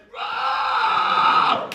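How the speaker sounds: loud, high screamed rawr; full monster roar. Acted. Chest burster jumping out to scare somebody, screamed roar. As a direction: burst out roaring at full volume. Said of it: "I'm gonna do it".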